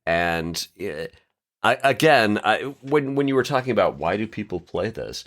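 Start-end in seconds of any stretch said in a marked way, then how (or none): none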